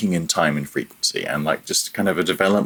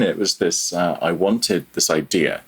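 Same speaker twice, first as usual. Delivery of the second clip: normal talking voice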